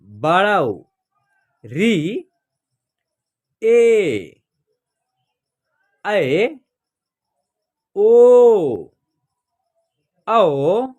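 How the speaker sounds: a slow, clear classroom chant of the vowels one by one; teacher reciting vowels. Shown as a demonstration. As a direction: sound out each Hindi vowel for the class to copy. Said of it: "देखिए इसका उच्चारण होता है अ".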